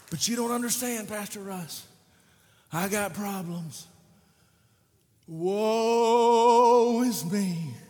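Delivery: hammed-up self-pity; mock whining. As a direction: drag out the woe-is-me whine. Congregant whining to the pastor.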